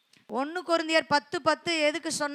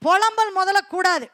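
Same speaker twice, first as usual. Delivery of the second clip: raised preaching voice; 5 semitones higher, 5 dB louder than usual. Acted. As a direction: declare it to the church. Preacher declaring. (பிரசங்க குரல்)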